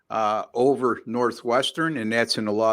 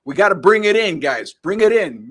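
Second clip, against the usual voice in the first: rallying bandleader, raised and loud on "bring it in"; Tom Berenger; 7 semitones higher, 7 dB louder than usual. Retold or imitated. Film bandleader telling the band to get tight, imitated rallying call. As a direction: imitate a leader calling the band together. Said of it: "Tom Berenger said to the band".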